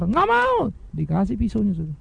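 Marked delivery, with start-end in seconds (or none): 0.0-0.7 put-on high-pitched childish refusal; mock stubborn child. Child refusing to wait for the knife, mock tantrum. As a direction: act out a kid digging in.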